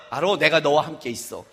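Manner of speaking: deep, soft, unbothered voice of God; God's low answer. Retold or imitated. God calmly answering a believer's excuse. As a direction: brush off the excuse gently. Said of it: "제 능력으로는 못해요".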